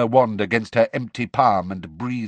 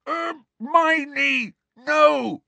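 high muffled shout; shouting through gag; 4 dB louder, much slower than usual. A narrator's reading. Gagged boy stopping his friend, muffled shouting. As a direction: shout as if the mouth is stuffed. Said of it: "shouted Ron through his gag".

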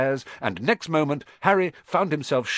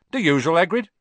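cheery barman; 6 semitones higher, 6 dB louder, faster than usual. Acted. Barman greets Hagrid.

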